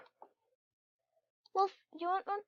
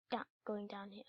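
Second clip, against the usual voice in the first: soft whisper; 6 semitones lower, 16 dB quieter than usual. Real, not acted. Player creeping into the mine, whispered. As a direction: whisper as you sneak down.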